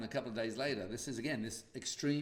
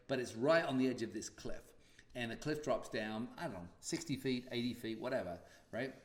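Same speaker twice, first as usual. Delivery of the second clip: ordinary talk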